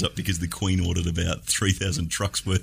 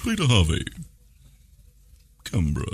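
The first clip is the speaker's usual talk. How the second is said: deep slow voice. Retold or imitated. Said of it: "talking in a deep slow voice".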